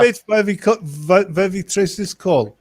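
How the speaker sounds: suspicious mock German accent. Shown as a demonstration. Prankster interrogates an unknown caller, mock German accent. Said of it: "mine isn't Russian".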